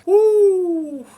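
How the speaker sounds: high, loud whoop; loud woo. Real, not acted. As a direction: let out a big whoop. Relief exclamation.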